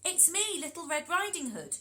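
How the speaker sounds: put-on child announcing herself; little girl. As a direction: call sweetly through the door. Girl at the door.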